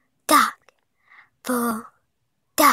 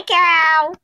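high loud call; 7 semitones higher, 7 dB louder than usual. Real, not acted. Calling the cow to greet.

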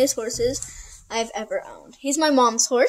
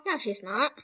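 ordinary talk, nothing marked

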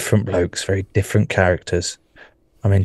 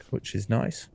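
normal talking voice

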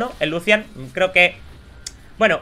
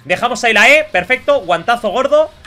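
excited; 5 semitones higher, 7 dB louder than usual. Real, not acted. Landing a big hit.

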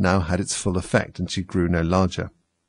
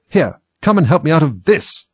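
high, loud, cross command; exasperated order in a raised voice; angry; 8 semitones higher, 6 dB louder than usual. A narrator's reading. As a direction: demand help irritably. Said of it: "said the rabbit angrily".

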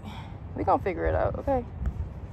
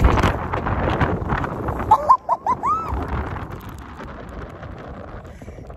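long, high, breathless laugh; helpless laughter trailing on and on; laughing fit. Real, not acted. Laughing as wind scatters the stuff, high wheeze. Wind blows packaging away.

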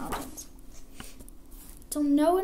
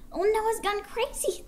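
high-pitched taunting townsfolk; mock Noah in a raised, put-on voice; scoffing onlookers, pitched high; jeering crowd; 7 semitones higher than usual. A narrator's reading. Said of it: "They laughed and they jeered".

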